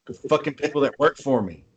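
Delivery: ordinary talk, nothing marked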